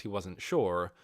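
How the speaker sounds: plain reading voice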